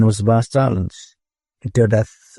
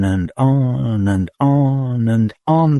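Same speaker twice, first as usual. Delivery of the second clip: plain reading voice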